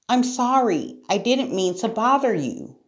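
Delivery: put-on high, meek girl's apology; timid storybook sorry; apologetic Sarah. A narrator's reading. Sarah apologises to the angry lion.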